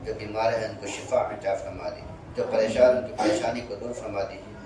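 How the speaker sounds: low, steady dua recitation; prayer. Acted. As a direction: pray quietly and humbly over the gathering. Closing dua after lesson.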